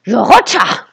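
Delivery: loud shout across the courtyard; bellow. Acted. Mustached adult calls for the child, a loud bellow. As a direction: call the boy's name loudly.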